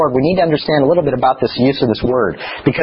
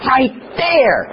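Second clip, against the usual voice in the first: put-on higher, a little kid insisting; piping toddler voice; child's voice; 11 semitones higher than usual. Father imitating his three-year-old pointing, higher kid voice. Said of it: "And he goes".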